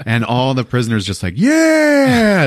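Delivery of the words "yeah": high, loud whooping yell; mock prisoner cheer. Prisoners cheering at the warden's promise, high loud yell. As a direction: cheer like a rowdy crowd of inmates. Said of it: "They start cheering".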